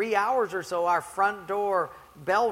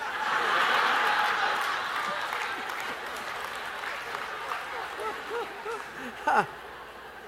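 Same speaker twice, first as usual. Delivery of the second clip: high airy laugh; 14 semitones higher than usual. Real, not acted. Laughter after punchline.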